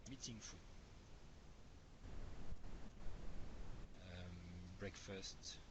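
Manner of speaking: faint, fading off the mic; barely audible. Real, not acted. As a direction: voice dropping almost to nothing. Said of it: "your voice is very low".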